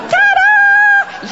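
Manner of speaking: high, loud, long flourish; triumphant ta-da demo. Shown as a demonstration. Speaker showing one side what to say, triumphant shout. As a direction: belt it out with showmanship. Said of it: "You're going to say one side".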